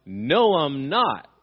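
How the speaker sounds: "No, I'm not" with NO and NOT punched loudly; emphatic denial. Shown as a demonstration. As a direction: hit NO and NOT hard, each swelling and falling. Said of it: "in an emphatic way".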